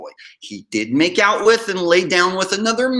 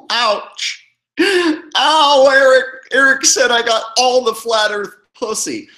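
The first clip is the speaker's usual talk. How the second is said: high, loud mock wail of pain; mock hurt; 8 semitones higher, 5 dB louder, slower than usual. Wounded target complaining about Eric's insult, mock hurt. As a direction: cry out as if wounded, hamming it up. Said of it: "That really hurts, man".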